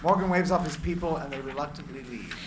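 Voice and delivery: usual speaking voice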